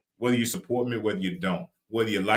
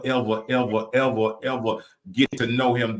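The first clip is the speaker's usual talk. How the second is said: ordinary talk, nothing marked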